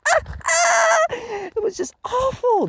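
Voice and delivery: a rant peaking in a disgusted cry; outraged shout. Real, not acted. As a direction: howl it in disgust. Outraged over bad music.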